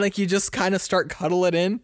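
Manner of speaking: ordinary talk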